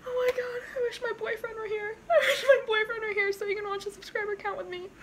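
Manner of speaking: high, weepy and laughing; wishful, through real happy tears; half laughing, half crying; tearful. Real, not acted. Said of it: "it's making me cry".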